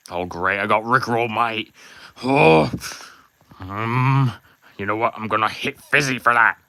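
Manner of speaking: put-on Australian accent, peeved; Mammon's Australian accent. Mammon, just Rickrolled by a fan, griping in an Australian accent. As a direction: grumble it in a showy Australian accent. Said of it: "nice Australian accent".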